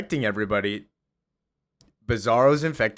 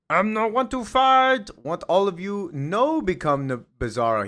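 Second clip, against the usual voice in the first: high, louder brute voice in broken English; Bizarro voice; 10 semitones higher, 3 dB louder than usual. Retold or imitated. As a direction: play the clumsy Bizarro.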